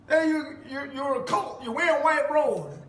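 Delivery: high, loud mock accusation; imitated accuser. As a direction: mimic an outraged critic, pitched well up. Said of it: "some of you will say".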